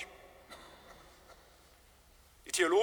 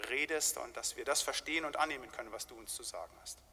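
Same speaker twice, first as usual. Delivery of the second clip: hushed, reverent prayer voice, much softer; 5 semitones lower, 9 dB quieter than usual. Acted. Pastor praying over the sermon, soft and reverent. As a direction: pray it quietly before the congregation.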